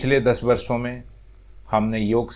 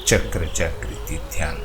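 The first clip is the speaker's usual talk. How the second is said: guided meditation; 2 semitones lower than usual. Acted. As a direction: guide them gently into stillness, phrase by phrase. Leads a chakra meditation.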